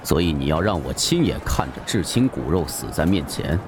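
low, contained imperial question; deep, grave voice. A narrator's reading. Emperor looks down on the raving avenger, asking in a deep voice. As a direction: ask it low and heavy, unmoved by the frenzy. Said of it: "沉声问道".

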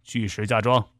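low, even reply, giving nothing away; impassive. A narrator's reading. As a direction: say it low and unmoved. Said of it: "不动声色地说".

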